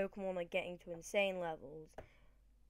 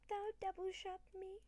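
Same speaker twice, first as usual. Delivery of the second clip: voiced Pokemon; 11 semitones higher, 6 dB quieter than usual. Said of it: "that Vaporeon is like".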